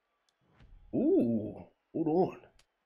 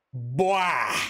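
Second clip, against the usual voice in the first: southern drawl; 4 semitones lower than usual. Shown as a demonstration. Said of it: "that southern ugh".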